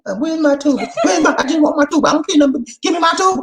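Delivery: put-on high, loud drunken whine; mock drunk Lisa begging for tuba; tipsy Lisa. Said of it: "She said".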